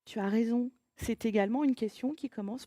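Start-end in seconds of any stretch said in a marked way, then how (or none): none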